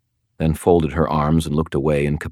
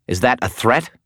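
snapping; 8 semitones higher than usual. Acted. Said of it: "Priscilla snapped".

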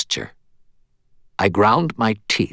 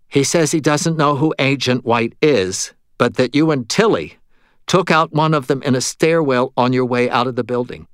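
higher-pitched, careful messenger voice; relaying medium; 4 semitones higher, 4 dB louder than usual. Acted. Medium relays the ghost's answer to Murphy, higher voice. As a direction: pitch it up and pass the answer along.

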